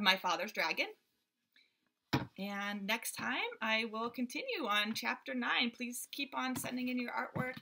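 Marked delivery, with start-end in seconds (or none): none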